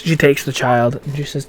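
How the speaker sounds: usual speaking voice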